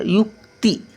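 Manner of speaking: युक्ती split, brief k then a full ती; light k, full ti. Shown as a demonstration. Teacher showing half and full letters, युक्ती in two parts. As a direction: stop युक short, then give ती fully. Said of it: "कचा थोडासा उच्चार आणि तिचा पूर्ण उच्चार".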